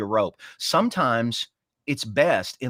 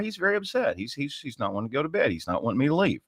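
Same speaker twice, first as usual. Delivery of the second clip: ordinary talk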